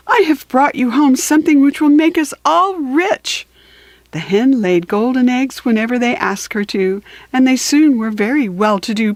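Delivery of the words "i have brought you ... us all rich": excited Jack. A narrator's reading. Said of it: "and said".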